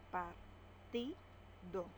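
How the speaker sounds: Partido slow, in separate beats par-ti-do; syllable by syllable. Shown as a demonstration. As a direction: split Partido slowly. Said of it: "Separamos en sílabas". (Partido sílaba por sílaba, lento)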